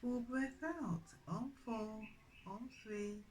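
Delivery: soft, unhurried counting of each breath; guided breathing count. Acted. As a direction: count the breaths in and out gently, like a yoga teacher. Leads the counted breathing practice.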